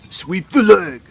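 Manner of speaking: loud, high mock spectator yell; yelling from the crowd. Game master as spectator cheering on a wrestling match, yelling. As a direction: holler it like a heckler at a fight. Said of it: "Somebody yells out".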